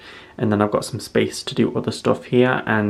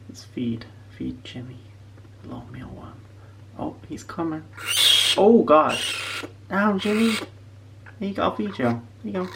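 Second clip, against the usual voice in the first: pet talk; 7 semitones higher than usual. Real, not acted. Talking to pet Jimmy.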